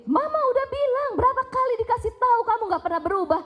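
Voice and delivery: put-on exasperated mother berating her child; hurtful angry scolding. Shown as a demonstration. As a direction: tear into the kid, rapid and cutting. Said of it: "Itu ekspresi kemarahan yang melukai".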